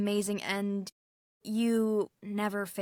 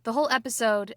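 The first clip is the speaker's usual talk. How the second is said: usual speaking voice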